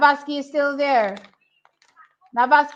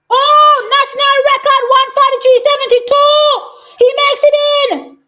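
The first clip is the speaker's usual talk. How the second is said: much higher and louder, yelling with joy; ecstatic shouting; 10 semitones higher, 11 dB louder than usual. Real, not acted. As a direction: cheer him into the final at the top of your voice. Watching national record set live.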